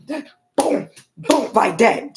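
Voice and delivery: high, loud booms, slowed down; imitated door bangs.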